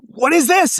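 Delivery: high, loud outraged question; angry demand. Retold or imitated. Wife confronting husband over card statements, angry. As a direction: imitate an outraged demand, voice jumping up. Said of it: "my wife was really pissed".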